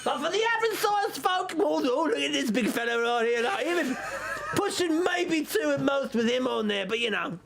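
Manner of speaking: lightly voiced shopkeeper sizing up customers; chatty trader making a sales pitch; haggling merchant. Same merchant answers the customers.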